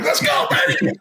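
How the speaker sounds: very high, squealed mock cheer; high-pitched celebratory cry, half laughing; excited retold cheer. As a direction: whoop it like an overjoyed fan, playing it up. Acts out his excited reply.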